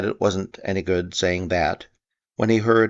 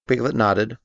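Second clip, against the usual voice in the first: ordinary delivery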